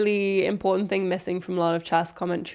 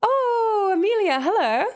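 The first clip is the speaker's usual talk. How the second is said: excited greeting; 12 semitones higher, 4 dB louder than usual. Real, not acted. Greeting guest joining the stream.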